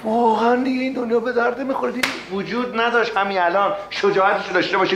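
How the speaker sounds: exasperated rant. Acted. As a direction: throw up your hands at the world.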